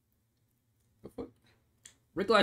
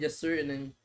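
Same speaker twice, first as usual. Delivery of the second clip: usual speaking voice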